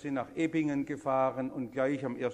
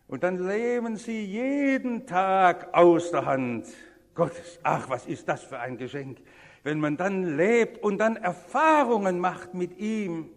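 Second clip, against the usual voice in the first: loud, raised preaching with stretched stresses; emphatic preaching; 4 semitones higher, 9 dB louder than usual. Real, not acted. Preacher urging listeners to live from God's hand, emphatic preaching. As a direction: proclaim it forcefully to the whole congregation.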